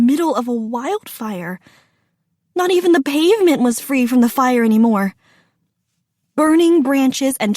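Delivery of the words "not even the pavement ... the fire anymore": ordinary delivery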